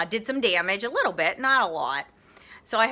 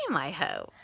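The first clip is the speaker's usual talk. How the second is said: ordinary talk, nothing marked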